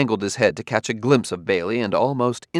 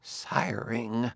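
dropped much softer, almost under breath; softer, embarrassed murmur; lower voice; 2 semitones higher, 9 dB quieter than usual. A narrator's reading. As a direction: let the word sink away quietly. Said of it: "he continued in a lower voice".

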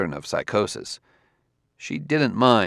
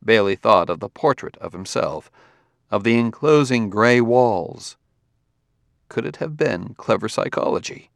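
plain reading voice

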